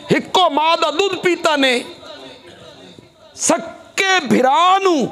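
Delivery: high, sustained preacher's cry; impassioned preaching. Real, not acted. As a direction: preach it out at full force. Preaches at full force.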